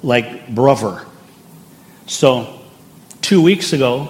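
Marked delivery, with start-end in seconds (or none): 0.5-1.0 Cockney "brother" with a V for the TH; slow Cockney demo of "brother", TH turned to V; Cockney accent. Shown as a demonstration. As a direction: say brother as "bruvver", Cockney style. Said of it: "T H in a sentence becomes a V".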